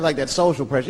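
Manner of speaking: ordinary delivery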